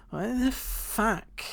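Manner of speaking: put-on high, drawn-out drunken outrage; drunken lady. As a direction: imitate an indignant drunk woman, stretched and high. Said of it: "there was this one drunken, somewhat tan lady".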